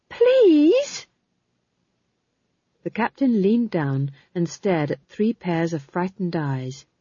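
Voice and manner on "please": one long, loud, very high plea; high desperate plea. Acted. Prisoner begging the captain not to gag them, desperate high plea. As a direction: beg at the top of the voice.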